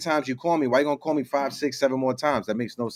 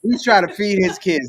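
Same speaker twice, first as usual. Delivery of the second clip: excited; 7 semitones higher, 5 dB louder than usual. Real, not acted. Echoes joke, higher and louder.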